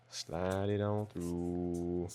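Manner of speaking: very slow, drawn out and soft. Shown as a demonstration. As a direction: stretch each word slowly.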